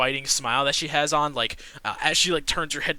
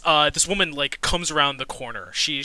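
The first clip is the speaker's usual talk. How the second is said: ordinary delivery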